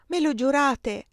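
a woman hiding her worry, asking him to swear; anxious plea. A narrator's reading. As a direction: beg for the promise, worry showing through. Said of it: "con inquietudine che si sforzava".

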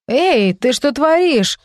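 alarmed cry; frightened. A narrator's reading. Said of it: "испуганно взвилась Грейс".

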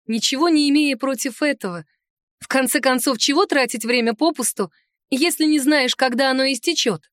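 usual speaking voice

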